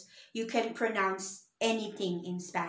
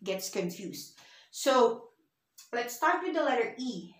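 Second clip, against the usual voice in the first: ordinary talk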